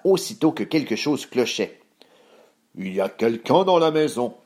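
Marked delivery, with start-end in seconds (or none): 2.8-4.3 put-on big bear exclamation; exclaiming Papa Bear. A narrator's reading. Papa Ours noticing an intruder, alarmed exclamation. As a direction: exclaim it as father bear, rising in the middle. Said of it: "s'exclama-t-il".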